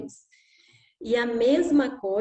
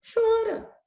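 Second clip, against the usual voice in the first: indulgent father; 11 semitones higher than usual. Retold or imitated. Father tells child to cry.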